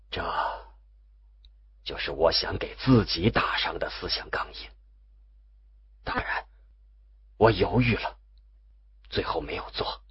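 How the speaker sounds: low, hushed, partly whispered; hushed confession. A narrator's reading. Confessing to Wu Yue what he nearly did, hushed. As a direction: confide it softly, with halting pauses. Confiding a secret near-deed.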